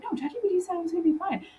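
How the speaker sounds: high, rushed, amused protest; mock insistence. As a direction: imitate someone brushing off all doubts, quickly. Acts out skit's trusting ChatGPT user.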